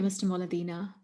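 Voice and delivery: usual speaking voice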